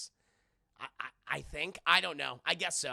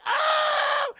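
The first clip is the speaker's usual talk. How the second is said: hammed-up startled shriek, very high and loud; mock scream; 13 dB louder than usual. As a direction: shriek in fake fright. Heroine startled.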